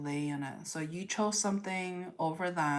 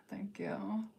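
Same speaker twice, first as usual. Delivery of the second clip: whispered thanks; 4 semitones higher, 5 dB quieter than usual. Real, not acted.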